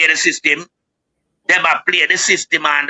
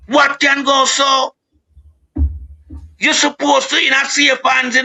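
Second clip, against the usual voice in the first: outraged shout; 7 semitones higher than usual. Real, not acted. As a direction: let it ring out like a public appeal. Appeal to Jamaican people.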